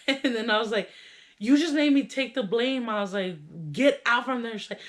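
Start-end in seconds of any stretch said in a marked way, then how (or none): 1.4-2.9 mock indignant outburst, higher and louder; retold scolding. 3.7-4.6 raised, higher and louder command; retold urgent order.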